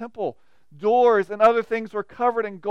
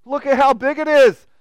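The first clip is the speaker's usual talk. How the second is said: gushing awe; 3 semitones higher, 8 dB louder than usual. Retold or imitated. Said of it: "you walk in and you go".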